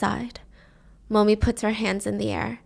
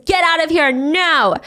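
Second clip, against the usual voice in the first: raised, urgent mock scream; 5 semitones higher, 4 dB louder than usual. Momi shielding the girls from Karen, urgent cry. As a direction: order them out urgently. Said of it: "and Momi screams".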